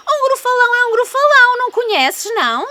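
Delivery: high, loud, fast boast; excited boast. Acted. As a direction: rattle it off with gusto.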